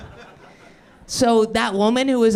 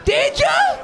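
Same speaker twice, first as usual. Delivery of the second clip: mock her with soaring sarcasm; sarcastic jab at her sister; sarcastic retort. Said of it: "And I was like".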